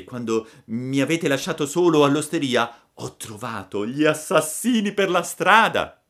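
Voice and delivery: high, loud puppet voice; a boy spilling his adventure; puppet storytelling. Acted. Pinocchio tells his tale. (burattino racconta, voce alta)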